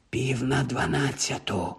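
slow, hushed whisper. A narrator's reading. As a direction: whisper it slowly, keeping it secret. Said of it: "прошепотів нарешті Рон".

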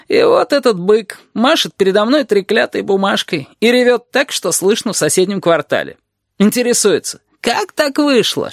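high-pitched sarcastic griping; aggrieved lizard. A narrator's reading. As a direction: complain hotly, capped with a bitter tag question. (ящер, возмущённо жалуется)

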